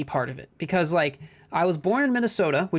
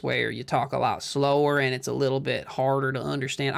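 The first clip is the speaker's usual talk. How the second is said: Southern accent; 3 semitones lower than usual. Shown as a demonstration. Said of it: "the Southern accent kinda sounds like this".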